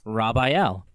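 RAPHAEL repeated as "Rob-eye-el"; punning pronunciation. Shown as a demonstration. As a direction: say RAPHAEL with Rob as its first syllable. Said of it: "Paulson's Raphael".

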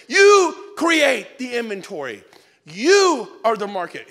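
slow, loud, emphatic shout. Real, not acted. Speaker rallying agents, slow booming shout. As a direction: hammer it home to the room.

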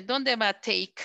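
usual speaking voice

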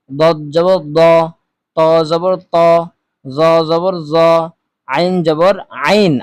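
steady, measured drill voice, each syllable called out; recitation. Shown as a demonstration. Teacher leading viewers through the zabar drill, measured recitation. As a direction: chant each letter slowly for pupils to repeat.

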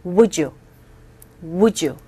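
"Would you" blended as "wu-joo", the d and y merged; linked "Would you" sound. Shown as a demonstration. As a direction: slide "Would you" together into one "wujoo". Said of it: "따라해보세요".

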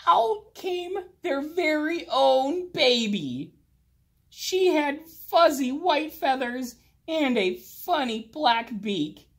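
put-on awed reveal of the baby; delighted narration. A narrator's reading. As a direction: tell it with delight.

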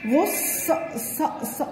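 "غص" said high, then "ص" hissed softly without voice; sounding out syllables. Shown as a demonstration. Teacher breaking "غصن" into sounds for a child. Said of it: "نشتهجها مع بعض كده".

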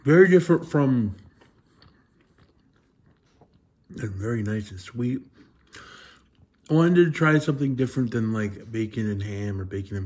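speaking between bites; tags: talking while chewing. Real, not acted. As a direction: mouth partly full of food. Seen 0: Bites pizza at -4; cheeks bulge and jaw chews while speaking.

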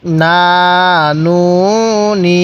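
"না, নু, নি" each held long and level; measured syllable chant of "না, নু, নি"; drawn-out vowels. Shown as a demonstration. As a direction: stretch "না, নু, নি" with pauses between. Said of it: "কিভাবে?".

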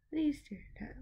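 soft whispered question. Real, not acted. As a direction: whisper it, curious. Whispering to cat video.

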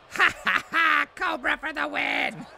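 high, gloating villain laugh and cry; cackle, then crow it in high pitch; Cobra Commander. Acted. Streamer imagining his victory speech, high villain voice. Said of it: "He always has that high-pitched voice".

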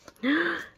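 sharp, high intake of breath; delighted gasp. Real, not acted. Fan seeing pink-haired favourite in new photos, delighted gasp. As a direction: gasp at a lovely surprise. Excited reaction to photo details.

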